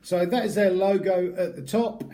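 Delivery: usual speaking voice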